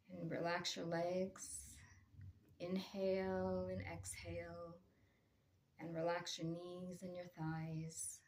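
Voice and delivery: slow, soft meditation voice with long pauses; guided meditation. Real, not acted. Meditation teacher guiding listeners to relax, in a slow, soft voice. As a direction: ease listeners gently into stillness, softening as you go.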